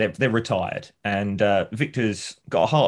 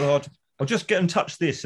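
higher, restless husband; breezily ignore her, pitched up; Victor fidgeting; 7 semitones higher than usual. Retold or imitated. Husband brushing off his wife, higher and restless. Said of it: "he goes".

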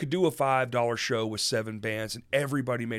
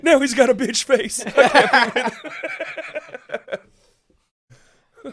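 high, loud laughing line; 12 semitones higher, 10 dB louder than usual. Pretend. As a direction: crack up while saying it. Laughing through the joke.

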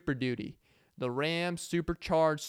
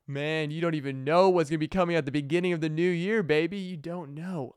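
louder, cocky teasing to an imagined listener; teasing boast, a little louder; hyped-up boast; 3 semitones higher, 5 dB louder than usual. Retold or imitated. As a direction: brag like you're hiding a big surprise. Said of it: "I'm like".

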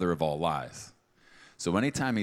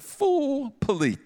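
much higher, louder mock woman's brush-off; sassy woman rejecting; 7 semitones higher, 5 dB louder, slower than usual. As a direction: play an offended woman telling him off. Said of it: "If I was a woman and you proposed like that".

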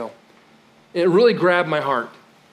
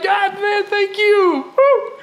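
hammed-up whooping praise, much higher and louder; mock cheering praise; 4 dB louder, much slower than usual. Preacher mocking churchgoers' easy praise, with hammed-up whooping excitement. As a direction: shout it like a giddy fan at a rally.

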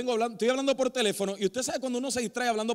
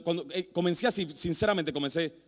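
usual speaking voice

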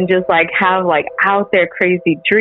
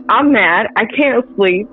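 usual speaking voice

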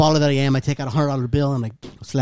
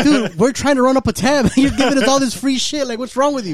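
higher, louder, laughing protest; mock outrage; 10 semitones higher, 4 dB louder than usual. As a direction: pretend indignation, cracking up. Mock outrage at free food.

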